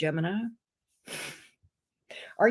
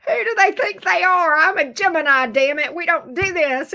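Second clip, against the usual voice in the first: indignant outburst; 6 semitones higher, 4 dB louder than usual. Acted. Gemini's indignant rant voiced.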